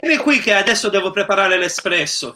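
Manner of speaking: hammed-up Neapolitan street talk; mock Neapolitan dialect. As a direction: ham up a Neapolitan gangster line. Mock Neapolitan Gomorrah-style dialogue.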